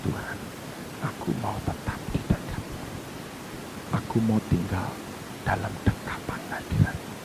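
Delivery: low, hushed, intimate; soft whispered devotion; whispered prayer. Acted. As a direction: pray softly as if close to God.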